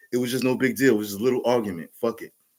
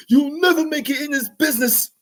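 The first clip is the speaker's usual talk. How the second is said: even higher, strained mock scolding, pushed further; repeated put-down, higher; 14 semitones higher, 4 dB louder than usual. Producer repeating his put-down, higher and harder. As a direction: hammer it home like a fed-up mentor. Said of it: "He was like / he was getting frustrated".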